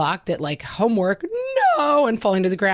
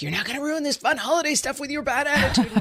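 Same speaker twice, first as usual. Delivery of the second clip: higher, laughing mock scolding; mock stern mom; 8 semitones higher, faster than usual. Mom scolding her tween during holiday fun, mock stern. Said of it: "got into the mind of".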